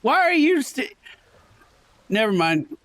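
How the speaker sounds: ordinary talk, nothing marked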